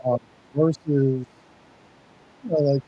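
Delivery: muffled, as if behind a wall; muffled, distant sound; through a wall. Real, not acted. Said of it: "you sound muffled".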